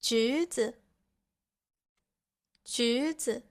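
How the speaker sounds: rising 橘, then short 子; second tone. Shown as a demonstration. Teacher giving Chinese examples, rising second tone on 橘. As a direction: say 橘子 with pitch climbing on 橘. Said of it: "the first character has the second tone".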